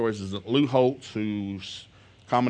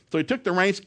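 plain reading voice

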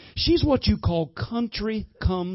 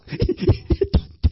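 high, breathy laugh; real laugh; 13 semitones higher than usual. Real, not acted. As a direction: let the laugh break through. Laughter breaks through.

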